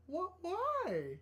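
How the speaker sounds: high, bewildered little squeak; scared and confused. Acted. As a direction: voice a startled, baffled character. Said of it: "kind of scared and confused she is".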